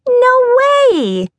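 put-on very high, loud child squeal; giggling little girl. A narrator's reading. Cleo refusing the scissors, high giggly child voice. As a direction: squeal it like a gleeful small girl. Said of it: "the little girl giggled".

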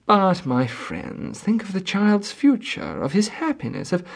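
persuasive last attempt, cut off; pleading husband. A narrator's reading.

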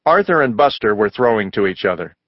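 usual speaking voice

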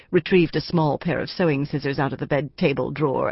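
usual speaking voice

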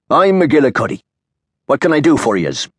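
lightly voiced Irish proprietor, swaggering; Irish barkeep. Irish saloon owner speaks.

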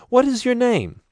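shy enthusiasm bubbling over; eager young woman. A narrator's reading. Shavana amazed that the stranger speaks their language.

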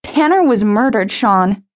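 a touch of a sob breaking the name; sobbing. A narrator's reading. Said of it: "Jenny sobbed".

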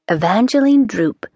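ordinary delivery, nothing marked

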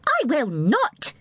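slow, high, firm refusal; a child queen refusing with flashing eyes; cried out. A narrator's reading. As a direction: stretch each word out defiantly. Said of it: "she cried".

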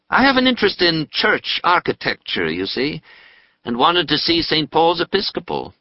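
put-on a polite young man explaining himself; young Nathaniel. Nathaniel answers the dentist.

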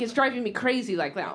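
lightly voiced low foreign man, deadpan; Stefan's accent. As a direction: repeat it as Stefan said it, mildly. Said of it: "he says it exactly like that".

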